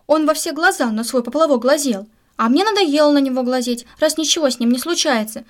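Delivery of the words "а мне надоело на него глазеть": ordinary delivery, nothing marked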